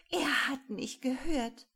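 high, thrilled exclamation; excited. A narrator's reading. Rosemarie tells Paule she was heard, excited and triumphant. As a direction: announce it high with real delight. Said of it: "Rosemarie war ganz aufgeregt".

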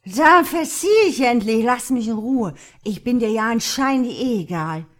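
put-on loud, high, drunken fury; angry outburst. A narrator's reading. Josh lashes out after Mark shoves him away, angry. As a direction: burst out shouting, hurt and angry.

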